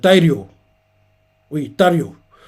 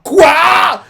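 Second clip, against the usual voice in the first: high, loud shocked shout; shocked cry; 13 semitones higher, 6 dB louder than usual. Retold or imitated. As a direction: blurt it out in disbelief. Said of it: "les Mizunori choqués font".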